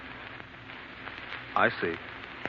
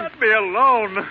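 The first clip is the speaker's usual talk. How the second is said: desperate plea; 7 semitones higher, 5 dB louder, slower than usual. Acted. Husband pushing wife away.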